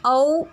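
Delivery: औ said high and carefully as au; औ vowel sound. Shown as a demonstration. Teacher models औ for the class. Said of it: "इस प्रकार उच्चारण होता है".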